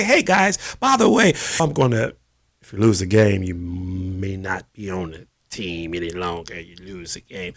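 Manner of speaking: a half-hearted owner's growl at his team; Jerry Jones impression. Owner warning his players before the game, low mock Jerry voice. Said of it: "It was a horrible Jerry Jones impression".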